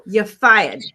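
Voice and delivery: loud mock Trump catchphrase; imitating Trump. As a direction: bark it like a TV boss firing someone. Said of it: "And that was another one".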